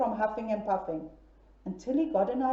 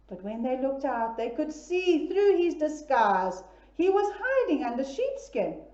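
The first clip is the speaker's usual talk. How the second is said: ordinary delivery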